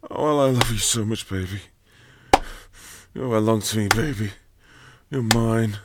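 loud breathless lover's talk; sweet nothings. Acted. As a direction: pour out passionate endearments. Passionate sweet talk building.

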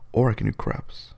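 ordinary delivery

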